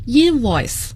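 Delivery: INvoice, first syllable stressed, said slowly. Shown as a demonstration. Teacher drilling invoice for listeners, stress on first syllable. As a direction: punch the IN of invoice. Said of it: "重音在第一个音节".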